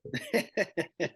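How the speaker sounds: high burst of laughter; real laugh. Real, not acted. Actor laughing at the host's bullying joke, amused. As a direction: laugh at being teased.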